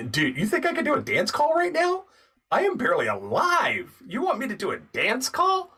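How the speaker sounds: high-pitched, swooping mock disbelief; incredulous protest. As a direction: protest as if it's absurd. Retold protest at dance audition.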